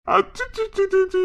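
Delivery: high, drawn-out "achi-chi-chi"; baby-soothing clucks. Acted. Hushing the crying baby, high clucking coo.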